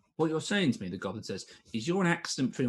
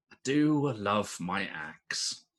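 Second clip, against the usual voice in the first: slow dwarf; much slower than usual. Acted.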